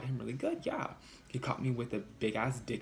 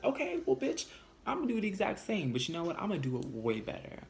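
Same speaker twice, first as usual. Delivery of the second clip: high, sassy clapback; sassy comeback; 7 semitones higher than usual. Retold or imitated. Answering her boyfriend who plans to meet a girl, high and sassy. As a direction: toss it back at him with sass.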